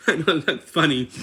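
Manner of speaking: high, loud, talking through laughter; helplessly amused, voice jumping up; real laughing. Real, not acted. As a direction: crack up while admitting it. Talks through laughter at faces.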